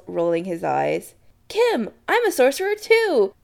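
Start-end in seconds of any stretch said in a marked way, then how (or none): 1.5-3.3 high, sing-out gleeful call. Acted. Adam to Kim in the kitchen, calling. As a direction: call it proudly to another room. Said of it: "Adam calls".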